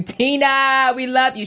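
high, gushing fan read-out; exclamation-point cheer. Retold or imitated. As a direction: pitch it up like an excited fan. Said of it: "exclamation point".

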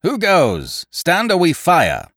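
sentry's high, loud challenge; ringing warning shout; loud hail. A narrator's reading. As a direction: hail a distant enemy sharply. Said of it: "Then he hailed the buccaneer".

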